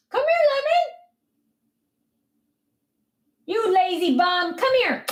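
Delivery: very high sing-song call; high-pitched call to Lemon; calling the dog. Real, not acted.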